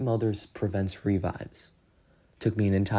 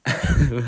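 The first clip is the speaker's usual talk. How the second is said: laugh; 5 semitones higher, 10 dB louder than usual. Real, not acted. Laughs at own note.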